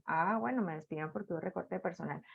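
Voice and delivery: very soft, low, quick murmur; quiet acceptance. Acted. Worker accepting a layoff to herself, in a low murmur. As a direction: drop right down, as if conceding it to yourself. Acted acceptance of the same reason.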